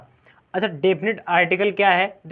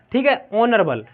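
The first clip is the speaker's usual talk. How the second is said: ordinary delivery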